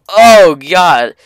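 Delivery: usual speaking voice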